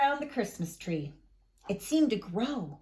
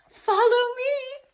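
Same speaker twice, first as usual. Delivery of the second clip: high, loud eager call; excited call; 12 semitones higher, 8 dB louder than usual. A narrator's reading. Madame Rosa calling the children to follow, excited. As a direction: lead them on with delight.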